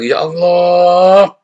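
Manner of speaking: slow, loud, drawn-out "Ya Allah" with a long sighing "Allah"; mock pious exclamation. Preacher mimicking Christians who say it, slow loud exaggerated sigh. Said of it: "Kalau ada orang Kristen bilang".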